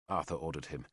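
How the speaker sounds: usual speaking voice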